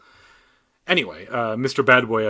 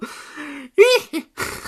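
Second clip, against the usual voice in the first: hyena giggle; 4 dB louder than usual. Pretend. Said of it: "my attempt at a hyena giggle".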